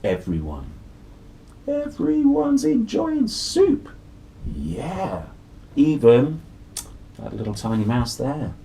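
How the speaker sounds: ordinary delivery, nothing marked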